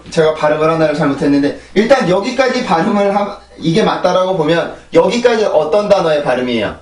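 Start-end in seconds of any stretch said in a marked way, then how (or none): none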